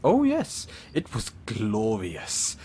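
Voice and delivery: ordinary talk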